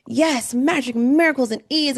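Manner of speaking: super hype. Retold or imitated. Recalling to her audience how hyped she first was, super hype. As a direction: act out being pumped for the big theme. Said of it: "super hype".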